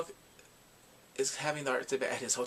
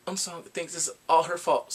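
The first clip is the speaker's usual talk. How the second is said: ordinary talk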